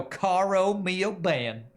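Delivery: "Caro mio ben" dragged out slow and high in a mock East Texas drawl, "ben" stretched to "be-en"; hammed-up Texas twang mangling "Caro mio ben"; bad Texas drawl. Mocking a Texan classmate singing Italian, slow exaggerated Texas drawl. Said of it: "some really bad drawl".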